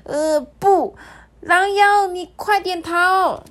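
high, loud straining cry; high desperate yell; desperate shout. Acted. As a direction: yell with your last strength. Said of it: "拼了最后一丝力气大喊".

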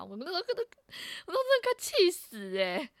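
ordinary talk, nothing marked

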